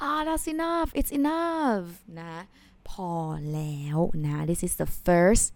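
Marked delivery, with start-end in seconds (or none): 0.1-1.9 higher, fed-up sigh of "enough"; exasperated enough. Shown as a demonstration. Teacher acting out a fed-up refusal, exasperated. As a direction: act out having had too much. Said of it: "It's just like".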